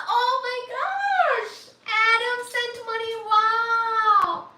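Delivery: high, thrilled squeal of disbelief; overjoyed, can't believe it; real delighted shock; excited. Real, not acted. Thrilled by donation.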